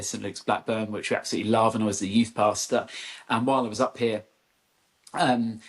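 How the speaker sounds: normal talking voice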